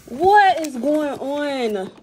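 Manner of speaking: slow, loud amazed question; drawn out in happy disbelief; pleasantly surprised. Real, not acted. Exclaiming over friend's fast gift.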